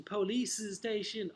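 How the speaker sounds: "police station" with an extra vowel after "police"; poor pronunciation. Acted. Teacher mimicking table three's mistake, poor pronunciation of "police station". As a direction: imitate a learner's "police-uh station". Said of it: "Poor pronunciation".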